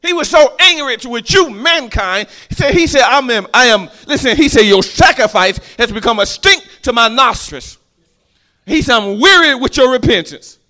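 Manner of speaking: loud, high, fiery preaching; preaching at full force. Acted. Preacher voices an angry God to the congregation, loud and high. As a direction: thunder the angry God at them.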